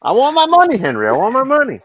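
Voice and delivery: nagging high-pitched complaint; whiny Morrie. Retold or imitated. Quoting Morrie's whine.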